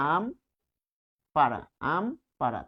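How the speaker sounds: আম, pause, পাড়া, pause; আম ... পাড়া, each part set apart; pausing between syllable groups. Shown as a demonstration. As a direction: stop after আম before saying পাড়া. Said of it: "থামছি".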